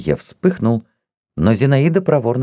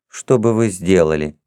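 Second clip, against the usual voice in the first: ordinary delivery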